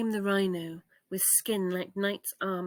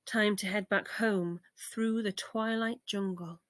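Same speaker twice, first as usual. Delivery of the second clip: usual speaking voice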